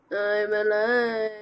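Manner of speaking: drawn-out goofy voice; slow silly voice. Pretend. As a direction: stretch it out, very slow. Slow put-on voice.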